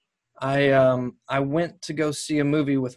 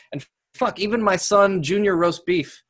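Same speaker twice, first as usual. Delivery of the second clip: ordinary talk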